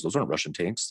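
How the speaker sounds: understated mock denier; offhand retold denial; dismissive denial. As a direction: deny it in an unbothered, ordinary pitch. Voicing denier in retold exchange.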